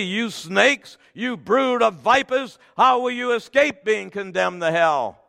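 loud, high preached denunciation; forceful condemnation. Acted. Jesus condemning the brood of vipers, forceful. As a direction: hurl the scripture's insults from the pulpit.

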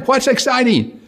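high, bewildered question; puzzled skeptic. Acted. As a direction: ask in mock confusion. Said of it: "skeptic asks".

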